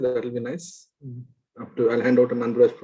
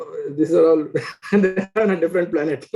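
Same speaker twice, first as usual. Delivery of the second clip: ordinary delivery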